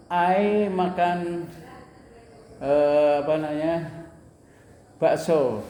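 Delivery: drawn-out, searching for the word; slow halting demo. Shown as a demonstration. Teacher shows a student how to fake through a sentence, slow and halting. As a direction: stall mid-sentence like a learner hunting a word. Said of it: "kalau lupa ya bilang aja".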